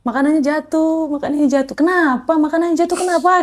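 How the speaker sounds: a hint of a weeping girl asking why; retold crying. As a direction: replay the tearful call, mildly. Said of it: "Saya langsung nangis".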